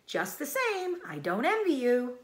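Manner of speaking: high, knowing sheep voice; old sheep voice. Acted. Old sheep warning Wilbur, high and knowing. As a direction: warn like a gossipy old ewe.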